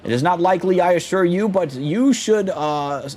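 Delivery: high theatrical boaster, delighted to sail home; pompous adventurer. Acted. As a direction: announce everything like a vain hero on stage. Pompous hero bids farewell.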